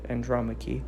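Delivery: usual speaking voice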